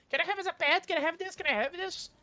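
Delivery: put-on high-pitched begging child; whiny kid voice. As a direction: plead like a kid wanting a pet. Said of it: "his son ... asking her".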